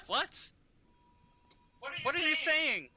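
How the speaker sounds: high, loud confused shout; calling out. Acted. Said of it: "you hear him call out".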